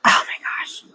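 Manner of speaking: breathless whispered gasp. Real, not acted. Whispering to herself at the song. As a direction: whisper it in awe.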